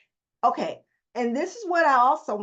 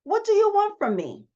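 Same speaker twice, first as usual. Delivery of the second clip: raised question; 9 semitones higher, 3 dB louder than usual. Shown as a demonstration. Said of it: "You gotta ask yourself that question".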